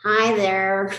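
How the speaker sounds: loud, drawn-out mock greeting; hammed-up, stretched-out hello; mimicking numb plumped lips. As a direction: send up someone with overfilled lips. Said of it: "Sometimes it's like this".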